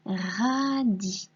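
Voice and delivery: "radis" in two long syllables, ra-di; sounded-out word. Shown as a demonstration. Teacher dictating a word to a pupil, slow syllables. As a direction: draw out "radis" so each sound is heard. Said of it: "bien entendre les sons qui composent le mot".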